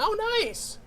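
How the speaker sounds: high squealed exclamation; playful high-voiced cheer; delighted surprise. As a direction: pitch shoots up in pleased surprise. Delight at surprise settlement money.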